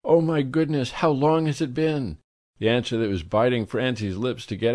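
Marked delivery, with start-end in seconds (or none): 0.0-2.2 lightly raised, gushy society lady; a breathless, effusive hostess greeting; a hint of a chirpy society matron; gushing socialite. A narrator's reading.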